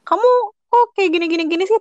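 high, loud scolding of a partner; loud public scolding. Shown as a demonstration. Partner scolded loudly in public, high and loud. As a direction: berate them loudly in front of everyone. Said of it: "ngomongnya kenceng dan di depan orang banyak".

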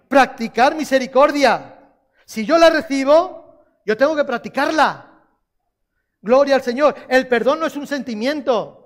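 high, loud preacher's call; preaching at full force. Acted. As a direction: rouse the congregation. (predicación enardecida)

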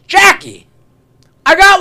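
loud, high-pitched imitation of an eager fan; excited calling out. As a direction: call out like an overeager stranger, easing off at the end. Man accosting comedian nose to nose.

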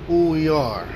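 normal talking voice

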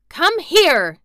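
high, loud urgent order; fierce summoning cry; shout. A narrator's reading. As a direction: shout for help imperiously. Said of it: "he shouted to Dickon".

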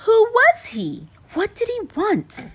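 very high, urgent questioning; bewildered cry. A narrator's reading. Animals puzzle over the vanished pig, crying out. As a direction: fire off high-pitched, baffled questions. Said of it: "the animals cried".

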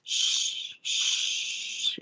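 soft whispered shh; hushing whisper. Acted. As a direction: hush him gently in a whisper. Mother hushing hurt son.

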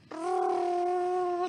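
high rolled rrr like a tape machine; cassette whirr. Retold or imitated. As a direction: imitate a cassette spinning fast. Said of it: "Niru ini nyetel kasete".

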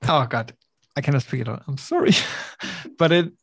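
ordinary talk, nothing marked

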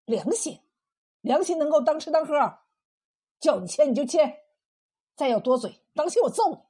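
gruff threatening order; bully her into obeying; bellowing husband. A narrator's reading. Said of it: "吼道".

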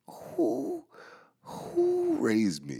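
very high, slow squeal; high-pitched drawn-out mock disbelief; falsetto mock astonishment. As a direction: stretch it out in a high squeak, amazed at yourself. Marveling at his own words.

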